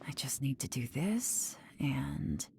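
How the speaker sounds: soft whisper to herself; murmured under the breath while concentrating; quiet whispered aside. Acted. Working the compass quietly.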